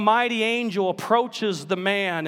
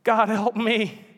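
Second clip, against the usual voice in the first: slow, high, broken plea; whimpering prayer; 4 semitones higher, 3 dB louder, slower than usual. Acted. Ghost giving in as the lizard dies, slow whimpering plea. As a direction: let it fall to a whimper. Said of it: "ended whimpering".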